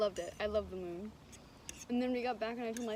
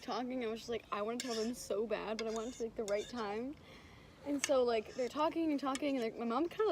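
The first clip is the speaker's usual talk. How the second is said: usual speaking voice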